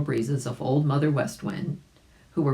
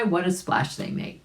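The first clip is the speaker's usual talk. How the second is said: ordinary delivery, nothing marked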